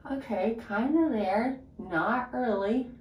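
talking while flossing teeth; fingers in mouth. Real, not acted. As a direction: lips held apart by hands. Seen 3: Mirror shows fingers and blue pick inside her mouth while speaking.

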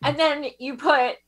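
ordinary talk, nothing marked

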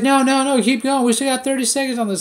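high, pushy mock instructor voice. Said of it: "And then she's like".

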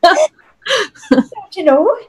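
slow, high mock-Minnesota accent; exaggerated Minnesotan long O. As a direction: stretch out a playful Minnesota accent. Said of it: "the right accent".

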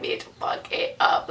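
awestruck whisper, no voice; retold whispered reaction. Fan retelling her reaction to the ending, whispering. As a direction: whisper it, re-enacting a stunned cheer.

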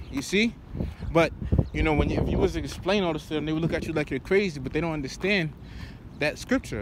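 normal talking voice

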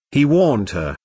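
plain reading voice

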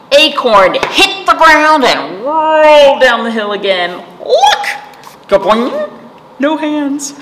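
high, loud giddy storytelling. A narrator's reading. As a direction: bounce through it like a kid. Narrator gets giddy with Acorn.

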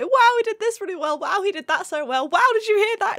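high, loud excited squeals; mock fangirl gushing over each note; gushing fan. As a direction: gush like a thrilled fan. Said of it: "pause it a lot and say".

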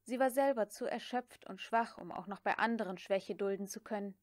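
usual speaking voice

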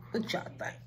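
soft whisper to the viewers; hushed whisper asking viewers their opinion; whispered question. Real, not acted. Asks viewers quietly.

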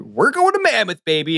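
mock fired-up cheer with a high swoop; hyped-up imitation of an excited friend. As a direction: play up the excitement of a trip, stretching it out. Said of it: "Kristen's really fired up".

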